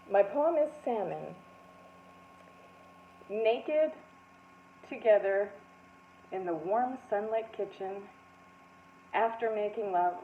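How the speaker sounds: shy poem reading. Retold or imitated. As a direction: read it timidly into the mic. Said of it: "I say".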